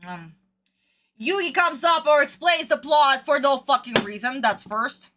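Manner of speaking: ordinary delivery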